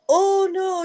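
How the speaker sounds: high startled oh no, imitating the mail lady; mail lady's shocked cry. Mail lady hit by the falling Jesus, high shocked cry. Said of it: "She's like".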